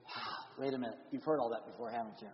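hushed playful aside; quiet teasing aside. Real, not acted. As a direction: drop your voice and wink at the crowd.

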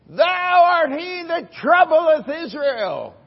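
loud, slow, high accusation; accusing king. Acted. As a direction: thunder it down at the prophet. Ahab accusing Elijah.

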